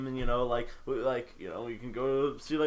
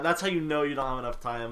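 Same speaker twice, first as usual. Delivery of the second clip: usual speaking voice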